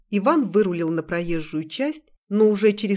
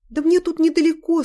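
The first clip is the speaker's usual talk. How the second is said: embarrassed; 9 semitones higher, 6 dB louder than usual. Acted. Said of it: "смутилась девушка".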